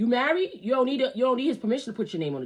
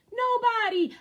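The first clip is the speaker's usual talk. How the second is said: high hype shout. Acted. Hyping up his own advice, high and proud. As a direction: sing out "Nobody" high and proud.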